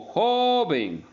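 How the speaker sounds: "joven" with "jo" stretched high and loud; stress on penultimate syllable. Shown as a demonstration. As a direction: hold and push "jo" in "joven", then fall on "ven". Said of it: "Tiene el acento en la penúltima sílaba". ("joven" con "jo" sostenido y fuerte)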